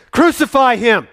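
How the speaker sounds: the mob's cry rising to a scream; screaming crowd. Acted. Crowd pressing Pilate harder, screaming demand. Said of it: "they gave a great shout".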